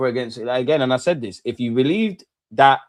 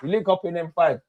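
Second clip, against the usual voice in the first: usual speaking voice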